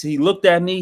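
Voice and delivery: normal talking voice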